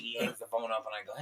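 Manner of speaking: normal talking voice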